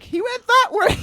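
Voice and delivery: very high, loud squeaky woman voice; high falsetto lady pointing the way; helpful woman. Retold or imitated. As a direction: squeal it out like a shrill bystander.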